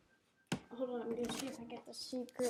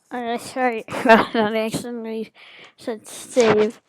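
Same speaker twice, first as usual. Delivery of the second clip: laughing; 16 dB louder than usual. Real, not acted. Laughing apology loudly.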